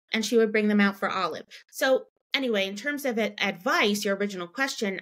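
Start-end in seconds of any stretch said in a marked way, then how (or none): none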